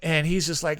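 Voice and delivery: ordinary talk, nothing marked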